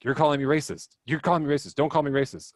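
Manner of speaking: mock the upset kid's outburst; retold angry yell. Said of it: "this kid's yelling at me".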